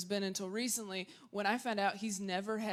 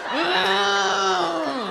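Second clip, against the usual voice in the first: angry groan; 6 semitones higher, 10 dB louder than usual. Retold or imitated. Her own angry groan.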